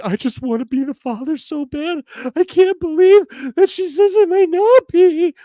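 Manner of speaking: hammed-up teary plea to be the father; mock crying guest. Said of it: "with a guy crying".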